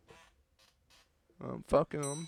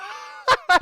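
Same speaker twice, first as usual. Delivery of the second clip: burst of laughter; 7 dB louder than usual. Real, not acted. Laughs at own Brett voice.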